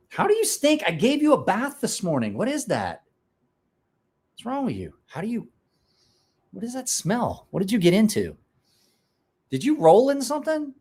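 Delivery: high, teasing dog-scolding voice; high playful scolding of a pet. Real, not acted. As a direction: tease the stinky dog in a high voice.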